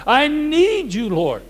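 high, slow plea; pleading cry. Acted. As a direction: cry out to the Lord. Impassioned plea to God.

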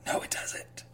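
dry whispered denial. Real, not acted.

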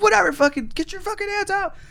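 high, urgent police command; yelling cop. Retold or imitated. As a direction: bark orders as an officer. Retold police yelling orders.

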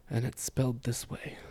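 soft unvoiced whisper to herself; whispered aside. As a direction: mutter it under the breath as a private thought. Inner thought while recalling spelling.

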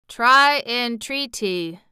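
lightly voiced machine, each word spaced out; machine character. A narrator's reading. Tick-Tock advising Ozma, slow and measured. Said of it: "replied the machine".

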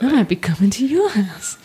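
menacing, teasing creepy tone. Shown as a demonstration. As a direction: play up the creepy stalker. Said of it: "in such a creepy voice".

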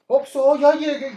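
slow, drawn-out street-slang imitation, with a laugh; mocking a guy arriving at the Oxxo in ñero slang. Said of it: "O co-, que llega en el Oxxo".

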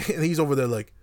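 ordinary delivery

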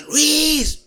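loud high shout of Luis; desperate yell. Retold or imitated. Calling his brother for help, he yells Luis. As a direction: yell the name badly, full force. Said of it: "pero gacho".

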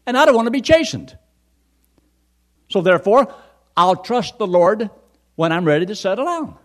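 high, loud, fast mock of the reluctant man; imitating an excuse-maker. Preacher mimics the man's excuse to the congregation, hammed-up. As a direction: rattle off his excuse in an exaggerated whiny voice. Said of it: "He says".

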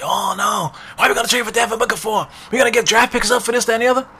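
high, shouting, outraged fan voice; angry fan rant. Retold or imitated. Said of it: "Knicks fans out there that's so angry".

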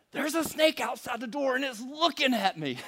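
imitating wife's panic; frightened urgency. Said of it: "the urgency that she had in her voice".

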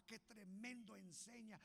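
usual speaking voice

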